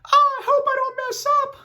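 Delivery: a silly high falsetto worry; high-pitched voice. Shown as a demonstration. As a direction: squeak it out way up high. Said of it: "I say it in a high-pitched voice".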